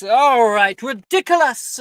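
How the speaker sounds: high, loud spell shout; spell cast. Acted. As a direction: fling the spell out. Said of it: "And Rojas goes".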